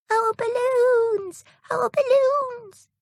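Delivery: very high, slow, sing-song call of tiny fairies; baby fairies calling out. Acted. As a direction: call out like excited little sea babies. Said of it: "calling out".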